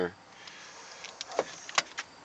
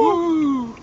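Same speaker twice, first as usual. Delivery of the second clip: playful whoop; 4 dB louder than usual. Real, not acted. Parent echoes kid's whoop.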